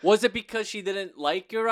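high, loud protest on the friend's behalf; sympathetic dismay. Real, not acted. Dismayed for friend's dropped accent.

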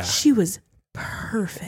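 slow, softened preacher delivery; mock preacher's inflection, slow and soft; imitating the preacher. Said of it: "He was like".

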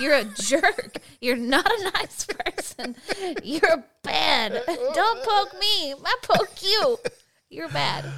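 high, loud mock scolding with laughter; playful scolding. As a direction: tease him like you're offended. Playfully scolding husband, poking.

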